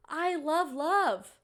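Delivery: slow, loud and high; swooning. Real, not acted. Gushing to viewers about a romance show, swooning. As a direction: draw it out, swooning.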